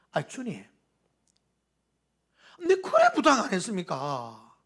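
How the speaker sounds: put-on complaining in a Gyeongsang accent, rising high with a laugh; whining at the Lord in thick Gyeongsang speech, half laughing; Gyeongsang dialect. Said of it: "우리 경상도 말로".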